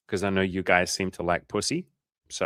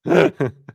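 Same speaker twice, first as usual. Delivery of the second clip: loud burst of laughter; 7 semitones higher, 10 dB louder than usual. Real, not acted. Laughing at chat joke.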